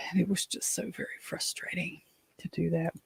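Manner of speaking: whispered, worn-out sigh. Real, not acted. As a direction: let it all out on a tired breath. Exhausted sigh over wasted day.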